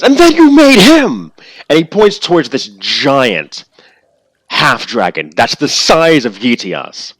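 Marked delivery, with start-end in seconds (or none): 0.0-1.3 much higher, very loud outburst; furious accusation. Acted. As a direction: hurl the blame at him. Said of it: "and then he says".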